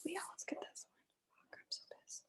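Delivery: faint, breathy whispered cursing; almost no voice, seething; barely audible whisper. Real, not acted. Angry woman cursing at her screen, near-silent whisper. Pissed, working the screen share.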